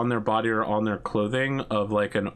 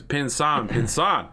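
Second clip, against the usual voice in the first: gang boss greeting; 5 semitones higher, slower than usual. Acted. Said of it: "he says".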